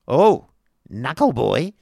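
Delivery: loud, impatient outburst. A narrator's reading. Wife answers schoolmaster about the new teacher, impatient. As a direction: toss the name out impatiently. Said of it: "said Missus Squeers impatiently".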